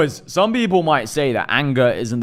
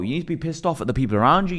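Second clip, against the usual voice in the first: usual speaking voice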